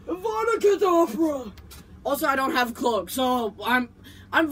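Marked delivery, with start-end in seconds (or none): 0.1-1.5 higher, louder wheezy villain curse; hammed-up Voldemort curse. Shown as a demonstration. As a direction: cast it like a breathless old dark wizard. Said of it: "if you're gonna be Voldemort".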